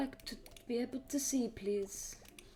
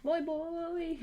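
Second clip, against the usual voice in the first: high playful call; 9 semitones higher, 7 dB louder than usual. Real, not acted. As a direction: call out in a high teasing lilt. Playful call at game character.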